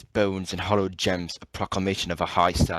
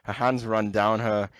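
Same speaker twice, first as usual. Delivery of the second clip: hammed-up fake British read; 4 semitones higher than usual. Reading in put-on voice, laughing.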